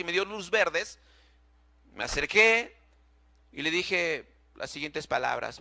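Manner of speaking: ordinary talk, nothing marked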